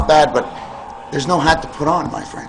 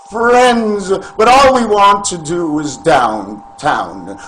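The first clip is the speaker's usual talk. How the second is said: slow mock-showman croon, pitched up and louder; Quack Show host; 3 semitones higher, 3 dB louder, much slower than usual. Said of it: "This is the Quack Show".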